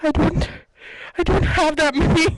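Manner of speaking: mock a clerk who gets flustered and backs down. Clerk outdone by allergy list, flustered and defensive. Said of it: "And she's like".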